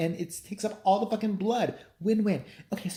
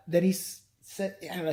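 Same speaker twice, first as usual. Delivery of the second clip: usual speaking voice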